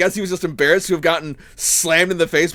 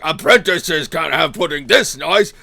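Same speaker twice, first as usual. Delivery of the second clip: mocking Gregor; 2 semitones lower, slower than usual. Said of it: "he's just like".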